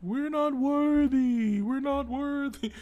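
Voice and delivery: high, slow pleading "we're not worthy"; bow down and grovel in a high voice; mock worshipper. Congregant groveling before God, high pleading.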